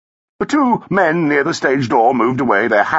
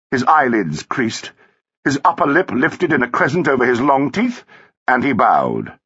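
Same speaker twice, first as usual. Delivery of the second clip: ordinary delivery, nothing marked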